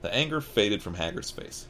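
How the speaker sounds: usual speaking voice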